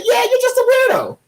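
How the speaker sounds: put-on high squeaky sneer of a kid; mocking teen retort. Kid sassing his teacher in a high squeaky sneer. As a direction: squeak it out like a smart-mouthed teen. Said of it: "I said".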